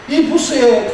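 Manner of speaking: usual speaking voice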